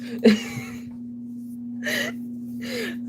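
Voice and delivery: high breathless wheeze; wheezing laugh. Real, not acted. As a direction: laugh until the voice squeaks.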